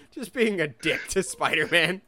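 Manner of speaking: usual speaking voice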